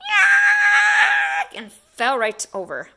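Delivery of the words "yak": very high loud yelp of fright; startled yelp. Acted. Man at the door shrieking in fright. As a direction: yelp and topple. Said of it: "The man yelled".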